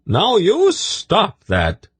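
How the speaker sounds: high, slow and sharp, scolding; sharp female voice. A narrator's reading. Tzadi scolding the snake bottle, sharp high voice. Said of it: "Tzadi said sharply".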